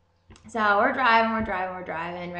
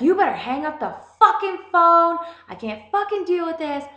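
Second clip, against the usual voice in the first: mock yell; 10 semitones higher, 5 dB louder than usual. Yelling at sister on the phone.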